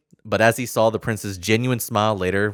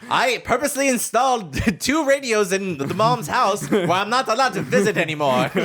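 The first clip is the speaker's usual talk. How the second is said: laughing comic voice; 9 semitones higher than usual. Acted.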